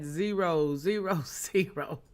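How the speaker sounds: slowly, each digit set apart. Shown as a demonstration. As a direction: draw the price out one digit at a time. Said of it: "I gotta say it like that".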